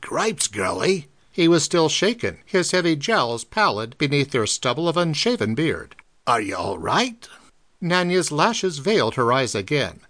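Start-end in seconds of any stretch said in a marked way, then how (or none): 0.0-1.1 1 shaken truck driver. A narrator's reading. 6.3-7.3 1 burly truck driver. A narrator's reading. Driver voiced lower.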